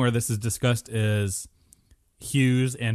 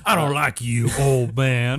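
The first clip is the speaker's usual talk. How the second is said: put-on Southern bayou drawl; bayou swamp man; 4 dB louder than usual. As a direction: play a swamp local from the bayou. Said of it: "the guy from the bayou".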